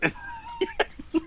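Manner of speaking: squealing burst of laughter. Real, not acted. Big laugh.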